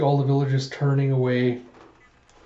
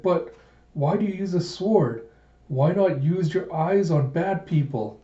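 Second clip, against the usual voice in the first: put-on higher-pitched little-kid voice; questioning child; 4 semitones higher than usual. Little dragon asking his guardian about the sword, puzzled. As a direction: puzzle it out as a young child, high and slowing.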